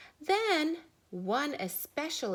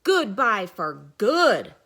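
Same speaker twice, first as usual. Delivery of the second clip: loud dismissal; 11 dB louder than usual. Acted. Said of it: "said Mister Duck".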